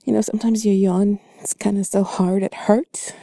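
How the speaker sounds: ordinary talk, nothing marked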